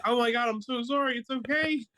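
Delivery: high, pleading retold apology; mock panicked apology. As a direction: fuss over a crying wife in a squeaky voice. Husband soothes crying wife, retold.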